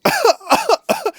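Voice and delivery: high squealing laugh; laughing at a pretend panic; burst of laughter. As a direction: break into a sudden high laugh. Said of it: "you're like".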